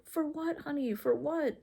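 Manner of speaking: put-on high, gentle questioning to a child; soft, high consoling mom voice; tender comfort. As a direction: soothe him, coax out the reason. Mother comforting crying son.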